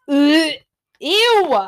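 loud "ugh", then high "ew"; disgusted groan. Real, not acted. Disgusted by smelly laundry.

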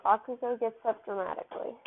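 usual speaking voice

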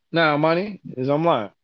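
ordinary delivery

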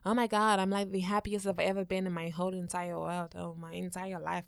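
lightly voiced American accent, soft; a mild American accent, trailing off; mocking a celebrity, a light American accent. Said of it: "I'm trying to do American accent".

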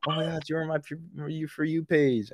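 high, gushing classmate voice; excited classmates. Retold or imitated. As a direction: gush like a starstruck friend. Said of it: "I was just getting snaps".

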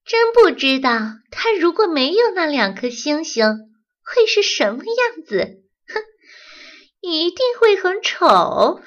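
high, loud mocking taunt; high mean teasing voice; sneering mockery. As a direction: sneer at him while imitating him. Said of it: "学着豆豆的样子".

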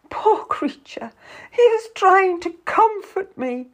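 high, tearful self-pitying lament; crying out. A narrator's reading. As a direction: wail it with a breathy sob. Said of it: "Lucy cries".

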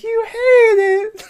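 very high, slow, tearful whine of the wife; mock whiny wife. Mocking the wife's tearful reply to husband. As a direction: whimper it like a hurt wife. Wife whining about hair.

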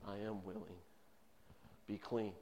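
soft, low, gentle assurance; tender Jesus. Acted. Jesus tenderly answering the kneeling leper: soft, low, gentle. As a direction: speak it softly, like a gentle healing word. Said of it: "his smile on his face said".

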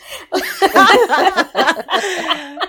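big high burst of laughter; a loud, high peal of laughter. Real, not acted. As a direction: crack up loudly. Laughs at her own joke.

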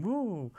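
high drawn-out "woo"; Ric Flair whoop. Retold or imitated. Reviewer imitating a famous wrestler's catchphrase, high whoop.

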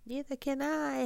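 high, wailing storybook howl; frightened bird's high wail, volume held back. A narrator's reading. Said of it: "howled Flitter".

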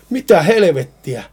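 startled exclamation. Acted. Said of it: "Johannes huudahdi".

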